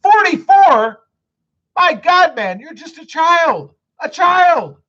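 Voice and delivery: high, loud teasing astonishment; amused disbelief. Real, not acted. Teasing a forty-four-year-old viewer, loud mock astonishment.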